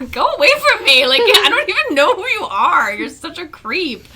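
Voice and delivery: loud, high-pitched outburst, easing at the end; exasperated yell. Retold or imitated. Telling friend how she rebuffs the creep, exasperated yell. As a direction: yell him off, half laughing.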